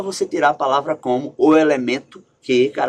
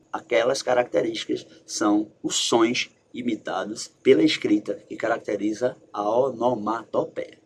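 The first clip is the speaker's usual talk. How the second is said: ordinary talk, nothing marked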